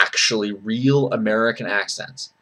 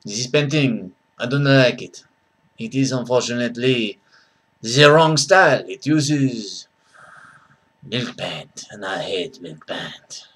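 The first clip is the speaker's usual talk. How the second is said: very bad French accent. Shown as a demonstration. Critic disliking a painting, very bad French accent. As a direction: do a badly faked French accent. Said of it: "a very bad French accent".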